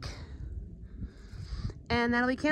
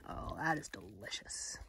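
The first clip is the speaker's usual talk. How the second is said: whispering; 11 semitones lower, 17 dB quieter than usual. Real, not acted. Tasting food, whispered.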